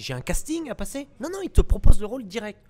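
high, loud back-and-forth; eager exchange. Acted. Comedian and agent about the offered role, high and loud. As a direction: push the surprise up.

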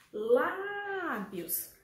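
"Lábios" with the LÁ held long and high; stressed syllable drawn out. Shown as a demonstration. Teacher showing pupils the strongest syllable, "Lábios" with LÁ stretched. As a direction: hold the first syllable of "Lábios", then drop. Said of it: "Sílaba mais forte".

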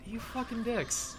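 high, soft, drawn-out curse; muted mock outrage. Player reacting to developers skipping fixes, muted mock outrage. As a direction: curse them slowly in a small high voice.

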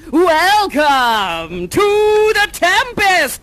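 slow, loud grand announcement; all dramatic. Acted. Host opening the Tempest for the kids, dramatic and slow. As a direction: boom it out like opening a great play. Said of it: "I'm gonna be all dramatic".

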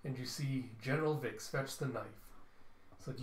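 ordinary talk

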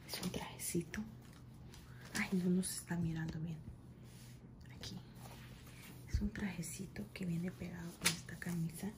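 hushed show-and-tell; ASMR-style whisper over new clothes; soft unboxing whisper. Acted. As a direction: whisper it close to the mic.

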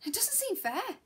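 high, rising, pleading "It doesn't seem fair". Acted. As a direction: lift the whole line high and let it rise at the end. Outburst, then repeated quietly.

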